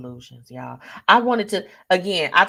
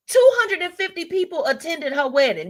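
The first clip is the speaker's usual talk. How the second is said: incredulous; 8 semitones higher than usual. Real, not acted. Incredulous at guest count, first take.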